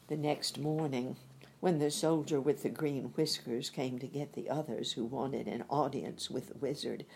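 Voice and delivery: usual speaking voice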